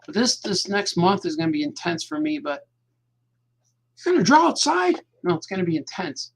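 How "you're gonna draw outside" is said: mock questioner voice. Mock voice asking himself question.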